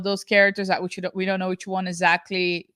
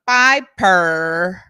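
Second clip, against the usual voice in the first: loud, drawn-out Piper; imitated Southern character; 7 dB louder than usual. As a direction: call out Piper in a long drawl. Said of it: "she called her daughter".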